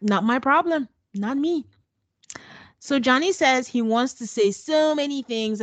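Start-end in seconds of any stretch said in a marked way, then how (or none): none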